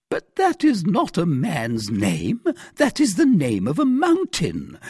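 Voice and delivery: high, loud exasperated protest; flustered cry. A narrator's reading. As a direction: cry out as you lose your head. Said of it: "cried the poor questioner, who began to lose his head".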